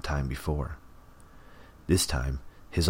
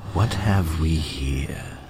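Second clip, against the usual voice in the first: slow, eager otherworldly voice; excited; 4 dB louder, much slower than usual. A narrator's reading. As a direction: savor it with eager delight. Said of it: "Leech sounded excited".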